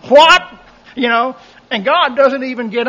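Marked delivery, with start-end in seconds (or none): 0.1-1.4 laughing. Acted. Laughing at the absurd report.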